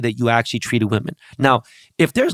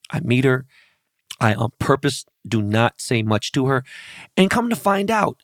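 ordinary talk

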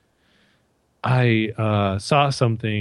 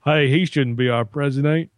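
Republican impression; 4 semitones higher than usual. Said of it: "the Republicans come on".

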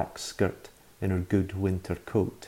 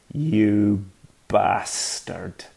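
hissed insult; 3 dB louder, much slower than usual. Acted. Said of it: "she hissed".

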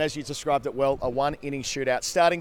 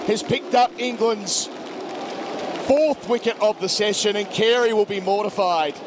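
cricket commentator; 4 semitones higher than usual. Real, not acted. Live call building to wicket.

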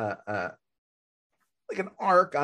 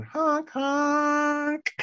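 high honking horn noise; silly clown; 11 semitones higher than usual. Pretend. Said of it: "I need a horn".

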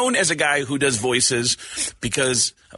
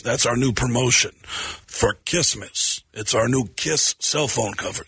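[name]; 5 semitones lower than usual. Retold or imitated. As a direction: drop low and pitch it like a promo. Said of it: "And Gene goes".

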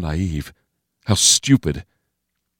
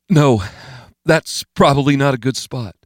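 higher, hedging objection; Steve's voice; 7 semitones higher than usual. A narrator's reading. Steve steering Bo Pan off the gash, nervous higher voice. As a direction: push back nervously, pitch well up.

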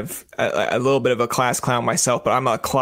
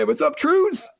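high cheeky greeting to the prime minister; jokey pitched-up hey bro; host teasing Trudeau in a bratty voice; cheeky mock voice; 13 semitones higher, slower than usual. Host imitates himself cheekily.

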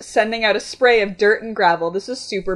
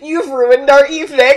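loud, raised-pitch accusation; seething; 5 semitones higher, 9 dB louder than usual. Acted. Young man blaming date for the night, seething. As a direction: hurl the blame in hammed-up fury. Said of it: "the young man seethed".

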